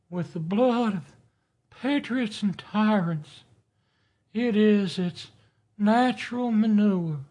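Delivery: high, thin, reedy Jefferson voice. Retold or imitated. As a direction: squeak it out like a reedy founding father. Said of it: "he had a thin, high, reedy voice".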